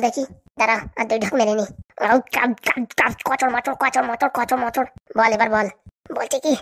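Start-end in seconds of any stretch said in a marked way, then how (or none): 2.1-4.9 slow, drawn-out crunching noises after a gulp; mock gulping and chewing. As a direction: take a swig, then chew slowly and noisily. Gulping drink then chewing.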